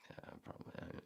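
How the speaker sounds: breathy, hushed agreement; imitating the lady's quiet nod of agreement; whispered aside. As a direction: lean in and whisper it. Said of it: "I saw her go".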